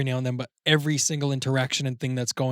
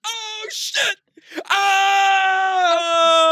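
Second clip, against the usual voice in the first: much higher, shouted mock shock; shocked audience; 7 dB louder, much slower than usual. Audience reacting to the ending, mock shocked shout. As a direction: yell out like a stunned audience. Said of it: "So people were like".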